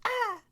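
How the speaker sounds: high piercing mock scream; squealing child scream; girls' scream. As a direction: shriek like scared little girls. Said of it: "the little girls would scream".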